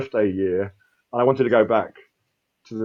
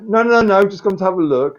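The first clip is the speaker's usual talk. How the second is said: high, louder breezy brush-off; tour boatman; 10 semitones higher, 5 dB louder than usual. Retold or imitated. Tour boat skipper telling the drifting swimmer he's only sightseeing, high and breezy. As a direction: mimic a cheerful boatman waving him off. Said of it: "And he's like".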